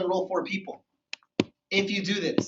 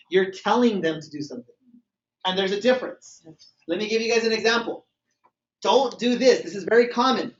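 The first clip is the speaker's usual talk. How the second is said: ordinary talk, nothing marked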